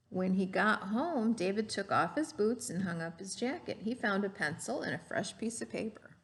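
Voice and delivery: lively storybook read-aloud; gentle classroom story voice; reading to children. Acted. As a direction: read it like a picture book to a class. Teacher reads picture book aloud.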